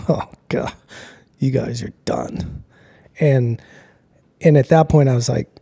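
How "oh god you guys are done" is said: hushed doom verdict. Retold or imitated. Stern bosses with arms folded.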